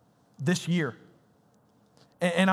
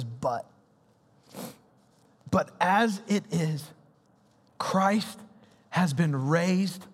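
scripture reading; 2 semitones lower than usual. Acted. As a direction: read it out slowly with reverence. Reads scripture aloud.

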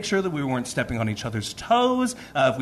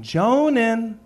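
put-on high, raised-voice call to a child; calling to him; 10 semitones higher, 5 dB louder, slower than usual. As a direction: call your kid over from across the house. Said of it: "I'm calling to him".

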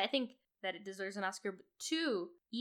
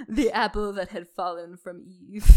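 grandiose recital; 3 semitones lower, 16 dB louder, slower than usual. Pretend. Mock florid line from the film.

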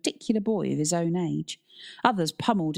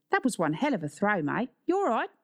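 friendly, higher-pitched Aussie coach; matey Australian teacher; Australian accent; 5 semitones higher than usual. A narrator's reading. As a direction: praise and check on a pupil in an Australian accent. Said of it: "It was Australian".

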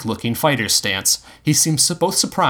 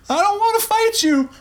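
very high, strained shout; high-pitched frantic yell. A narrator's reading. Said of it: "the doctor shouted".

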